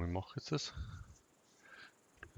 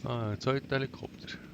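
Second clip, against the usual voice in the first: excited; 6 semitones higher, 5 dB louder than usual. Real, not acted. Second helicopter arrives in game.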